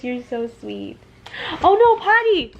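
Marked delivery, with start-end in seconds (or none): 1.6-2.5 sudden high, loud alarm; alarmed cry. Real, not acted. Mom sees her toddler peeing mid-sentence, alarmed. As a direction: blurt it out as you spot the accident.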